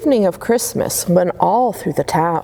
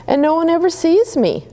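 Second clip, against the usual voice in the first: small star; 10 semitones higher than usual. A narrator's reading. Small star voiced high.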